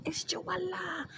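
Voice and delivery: high, hushed, drawn-out squeal; mock crazy. Shown as a demonstration. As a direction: fake a wild intro under your breath.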